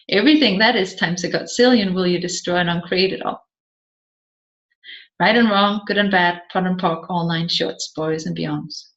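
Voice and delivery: a practised incantation, quick then trailing away; rapid clearing statement. Acted. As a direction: rattle off the formula fast, fading to a low murmur.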